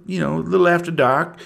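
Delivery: lightly voiced anxious mother, halting; a mother recounting a missing daughter, mildly fretful; a hint of anxious small-town woman; worried mother. Game master voices worried mother.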